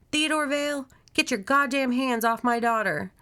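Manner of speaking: high, loud confrontation; furious shout. A narrator's reading. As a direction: shout it like a mother defending her child. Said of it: "she shouts".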